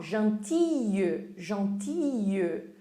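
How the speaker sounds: "gentille" said slowly with no L sound, ending in a y glide; "gentille" said slowly as "zhan-tee-y"; slow French demo. Shown as a demonstration. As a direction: stretch out "gentille" like a teacher, without pronouncing the L. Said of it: "Eu falo gentille".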